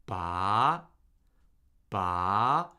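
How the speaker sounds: "Ba" rising from low to mid; second tone. Shown as a demonstration. Teacher models Mandarin second tone for learners, rising. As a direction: lift "Ba" up like a question. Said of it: "Okay, second tone".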